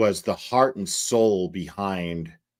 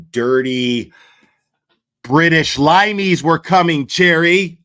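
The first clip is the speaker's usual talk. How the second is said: rising mock-outraged yell, much higher and louder; jokey Paul Revere alarm call, much louder; mock alarm shout; 8 semitones higher, 9 dB louder than usual. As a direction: ham up a revolutionary warning cry. Mock patriot alarm, then teasing.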